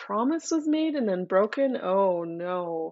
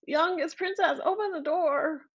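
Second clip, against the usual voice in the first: high pleading frog call; 9 semitones higher than usual. Acted. Frog calling at the door.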